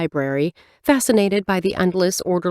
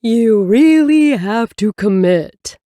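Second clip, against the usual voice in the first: slow, loud playful monster growl; funny monster voice; 2 semitones higher, 6 dB louder, slower than usual. Acted. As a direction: ham it up like a cartoon monster. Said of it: "she now makes a funny, deeper monster voice".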